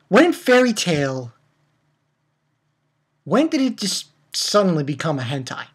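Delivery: ordinary talk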